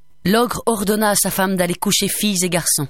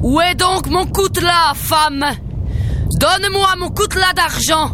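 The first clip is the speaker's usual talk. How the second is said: loud, much higher bellow; raging shouts through the house; grumbling ogre; 12 semitones higher, 3 dB louder than usual. A narrator's reading. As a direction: ogre demanding his knife. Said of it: "grommelait l'ogre".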